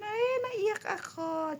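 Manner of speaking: high, slow crying wail; mock wail as if in tears; naiyak. Recalling crying over his message. (parang umiiyak, mataas at mabagal)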